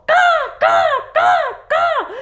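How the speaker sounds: high crow calls with a laugh; excited crow caws. Acted. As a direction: caw with joy over the eggs. Crows counting their eggs.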